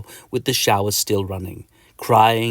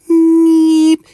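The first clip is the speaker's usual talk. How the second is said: high, loud buzzing neep of a mosquito; tiny insect squeak; mosquito whine; 10 dB louder than usual. Acted. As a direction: whine like a mozzie in your ear. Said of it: "to kill the mozzie".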